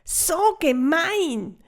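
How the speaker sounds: a little fairy furious at the thief; indignant cry. Acted. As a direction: stretch it out in childish fury. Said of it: "ist verrückt worden".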